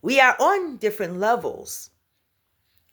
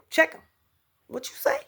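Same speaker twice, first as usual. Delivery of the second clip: higher, sharp challenge; sassy confrontation; 8 semitones higher, 4 dB quieter, faster than usual. Pretend. Standing up to a disrespectful person, sassy. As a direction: snap back at someone disrespectful.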